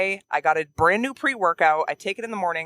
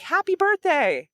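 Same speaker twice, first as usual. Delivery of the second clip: happy birthday call; 13 semitones higher, slower than usual. Retold or imitated. Calling birthday wishes across course.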